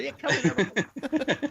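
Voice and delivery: high, sing-song mock greeting; mock welcome. Host mocking office visitors, high joking welcome.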